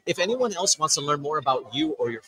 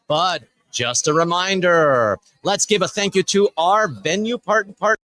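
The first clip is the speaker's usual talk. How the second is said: announcer; 3 semitones higher, 5 dB louder, slower than usual. Real, not acted. Host addressing the festival audience.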